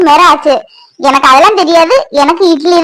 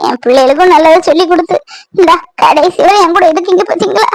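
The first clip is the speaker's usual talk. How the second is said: normal talking voice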